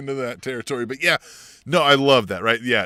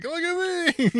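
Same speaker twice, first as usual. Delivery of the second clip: put-on very high, bouncing child's brag; little kid voice; slower than usual. Cohost imitating Cole bouncing, high childlike voice. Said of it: "like a little kid".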